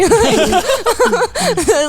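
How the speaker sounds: a loud high laugh bursting out; high burst of laughter. Real, not acted. Cracks up high and loud over the idol card joke.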